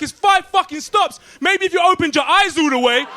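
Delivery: high, loud imitated tirade from an angry lady; mock furious shout. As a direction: snap at the tourists, high and loud. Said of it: "She was like".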